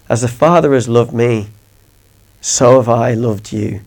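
soft, gentle voice of Jesus; tender Jesus. Acted. Preacher voicing Jesus to his listeners, soft and tender. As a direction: speak it softly, like a loving promise.

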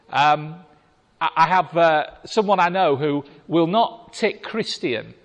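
ordinary talk, nothing marked

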